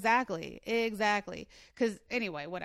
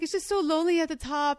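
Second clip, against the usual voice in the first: 1 dramatic diva; 7 semitones higher, 5 dB louder than usual. Pretend. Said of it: "I was being so dramatic".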